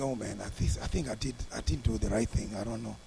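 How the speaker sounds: low, hushed thinking to himself; inner doubt. Retold or imitated. Pastor doubting himself after leaving the man, low hushed self-talk. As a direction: voice an uneasy inner thought, soft and low. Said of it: "back in my mind, I'm feeling".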